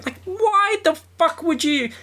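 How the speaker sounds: high, disbelieving outburst; incredulous rant. Real, not acted. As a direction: sound baffled and fed up. Ranting about the silly phrase.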